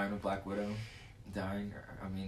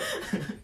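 laugh; 10 semitones higher than usual. Real, not acted. Laughing at own voice crack.

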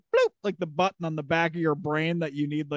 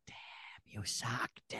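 whisper; 5 semitones lower, 15 dB quieter than usual. Retold or imitated. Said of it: "whispering in the back of the recording".

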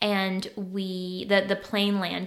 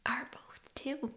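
whispered aside; 4 semitones higher, 12 dB quieter, slower than usual. Real, not acted.

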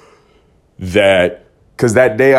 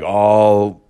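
ordinary delivery

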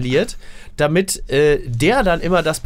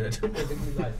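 ordinary talk, nothing marked

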